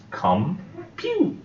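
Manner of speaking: "Computer" stretched high and slow, syllable by syllable; slowly syllabified. Shown as a demonstration. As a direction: pull "Computer" apart into its three parts slowly. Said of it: "Three syllables".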